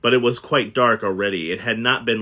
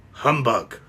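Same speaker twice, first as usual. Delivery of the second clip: grumpy humbug. Acted. Scrooge with no better answer, sour humbug. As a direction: sneer it like an old miser. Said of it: "followed it up with".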